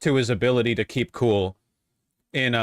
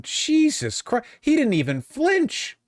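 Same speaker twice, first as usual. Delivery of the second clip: high, slow, drawn-out amazement; real shock, words stretched high; stunned disbelief; 13 semitones higher, slower than usual. Real, not acted. Streamer reacting to a rude interview question, stunned and high.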